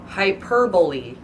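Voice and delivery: slow, careful Hyperbole as hy-PER-buh-lee; Hyperbole said slowly with stress on PER; correct pronunciation. Shown as a demonstration. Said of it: "That's how it's actually pronounced".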